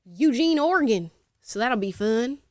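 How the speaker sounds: playful Southern twang, pitch lifted on Oregon; Southern accent. Pretend. Host plugging a podcast in Eugene, Southern accent. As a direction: slip into a put-on Southern accent. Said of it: "I did a Southern accent".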